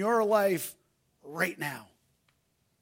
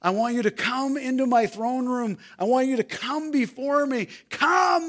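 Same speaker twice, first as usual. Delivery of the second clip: rising fervent invitation; 3 semitones higher than usual. Acted. Preacher voicing God's invitation, building to a cry. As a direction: swell into a passionate plea. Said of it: "He says".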